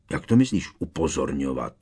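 plain reading voice

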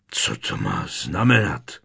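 low, hoarse demand. A narrator's reading. Strýc Vernon questions the strange letter, hoarse. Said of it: "zeptal se chraptivě strýc Vernon".

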